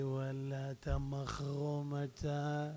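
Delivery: low, slow, soft put-on voice; listener. Man approaching preacher after his talk, low drawn-out put-on voice. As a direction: imitate a slow-talking audience member. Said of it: "אחד בא אליי אחרי זה".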